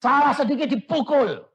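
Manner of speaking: high, loud scolding voice; mock stern scolding. Preacher mimicking a strict parent, high loud scolding.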